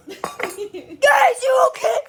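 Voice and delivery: shouted check-in; loud call. Real, not acted. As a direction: call out loudly to the camera. Shouting to camera.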